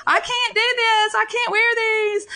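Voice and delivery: high, whiny mock crying; hammed-up child's wail; imitating a whiny cry. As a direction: mimic a child wailing over his shoes. Mother imitates crying son.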